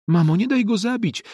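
high pleading cry, stress on daj; child's cry. A narrator's reading. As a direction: beg mother to save it, crying out. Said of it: "wrzasnął nasz najmłodszy brat".

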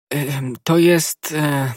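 slow, halting stammer with fillers. Acted. Milady cornered by the novice's question, stammering. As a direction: stumble for words, caught out. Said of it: "jąkała milady".

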